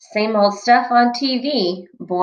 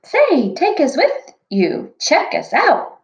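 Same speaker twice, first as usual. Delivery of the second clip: dwarfs shouting; 7 semitones higher than usual. Acted. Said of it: "The seven dwarfs begin to shout".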